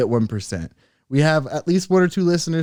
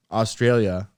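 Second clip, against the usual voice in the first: ordinary delivery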